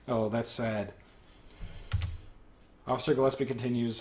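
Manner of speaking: ordinary talk, nothing marked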